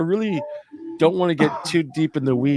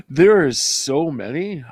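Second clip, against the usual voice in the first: slow words through a laugh; chuckling, drawn out; 5 semitones higher, slower than usual. Real, not acted. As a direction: laugh while admitting there are many. Laughing at being asked.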